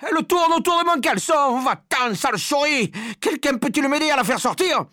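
high, loud hysterical pleading; panicked shouting. A narrator's reading. Man with a mouse round his underpants, shouting frantically. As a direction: rant in terror and beg for help. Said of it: "criait l'homme".

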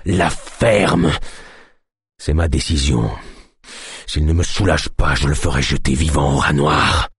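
low, harsh command; angry sick chief. A narrator's reading. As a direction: silence him through pain. Sick chief silences the healer.

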